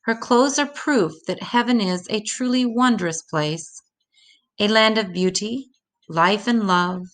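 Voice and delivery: plain reading voice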